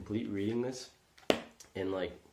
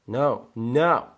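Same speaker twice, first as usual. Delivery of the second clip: louder, higher frustrated no; frustrated shout; 4 semitones higher, 13 dB louder than usual. Real, not acted. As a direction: yell it out in annoyance. Frustrated at software.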